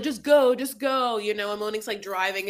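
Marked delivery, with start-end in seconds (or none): 0.0-1.2 loud, urgent retold yell of go; impatient yell. Husband urging wife to drive away, loud. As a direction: hurry her off, frustrated. Derek impatient, car blocked in.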